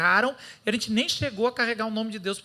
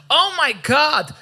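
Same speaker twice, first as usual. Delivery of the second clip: slow, loud, drawn-out Oh my God; said really loud; 5 semitones higher, 10 dB louder, much slower than usual. Retold or imitated. Newcomer at church blurting it out to friends, drawn-out and loud. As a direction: blurt it out big across the room. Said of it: "eu peguei e falava bem alto".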